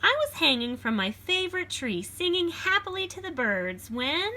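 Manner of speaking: put-on lively read-aloud for children; storybook reading. A narrator's reading. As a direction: read it like a picture book to a class. Reads beast's version.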